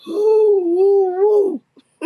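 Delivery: high, loud amused whoops; big drawn-out woo; loud whooping. Real, not acted. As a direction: whoop it out three times. Whooping after long comic name.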